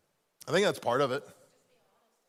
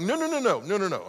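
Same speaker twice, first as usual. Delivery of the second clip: higher, emphatic string of no; firm refusal; 5 semitones higher than usual. Retold or imitated. Speaker cutting off the pastor's pitch. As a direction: shut him down fast.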